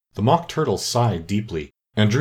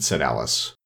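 usual speaking voice

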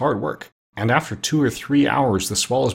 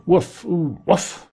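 mock woof, higher, sleepy dog; dog bark; 7 semitones higher, much slower than usual. Sleeping dog Jip barking at pirates, mock woof. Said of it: "Then he barked loud".

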